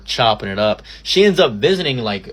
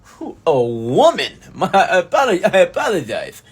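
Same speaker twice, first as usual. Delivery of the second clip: higher, laughing put-on gentleman voice; mock posh apology; 5 semitones higher, 3 dB louder than usual. Mocking his own overly formal reply to the manager's wife, posh put-on voice. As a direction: fake a flustered gentleman's apology. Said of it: "I'm like".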